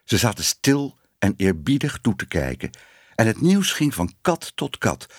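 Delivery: plain reading voice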